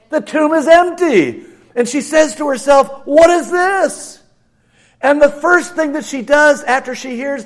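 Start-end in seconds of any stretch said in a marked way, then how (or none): 3.1-4.2 bewildered question. Acted. Mary finding the empty tomb.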